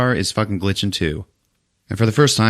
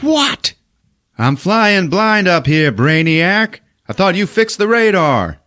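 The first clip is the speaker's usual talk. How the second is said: much higher, louder hammed-up rant; mock angry yell; 11 semitones higher, 4 dB louder, slower than usual. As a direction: rant at the mechanic. Captain yelling at mechanic.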